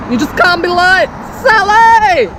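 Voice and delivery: high, loud mock telling-off of Sally; hammed-up scolding shout. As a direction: scold Sally like a fussy mum. Scolding herself playfully.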